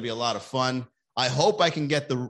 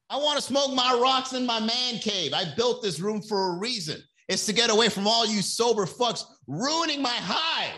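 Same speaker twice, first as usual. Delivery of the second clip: higher defiant addict voice; lightly voiced defiant crackhead; crackhead husband; 9 semitones higher than usual. Mocking the addict in his man cave: higher, defiant. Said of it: "becoming an episode of Intervention".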